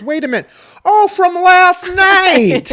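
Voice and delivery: high, loud, slow realization; imitating mom. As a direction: squeal it out as mom. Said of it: "And my mom goes".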